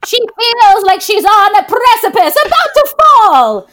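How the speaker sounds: put-on grand, theatrical old-woman voiceover, swelling as it goes; old Rose. Co-host doing the film's voiceover on request, hammed-up old Rose. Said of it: "[name], do the voice".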